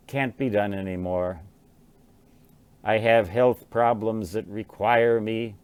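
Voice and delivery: ordinary talk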